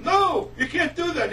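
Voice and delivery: high, loud, slow protest; stretched-out frantic objection; imitated panicked plea. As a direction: cry out to stop him in mock panic. Kid chasing man, panicked plea.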